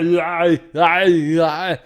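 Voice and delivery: loud, high hammed-up yeahs; mock muscleman. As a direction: grunt it out like a meathead. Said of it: "He's like".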